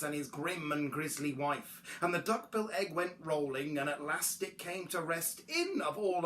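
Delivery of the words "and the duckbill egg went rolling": usual speaking voice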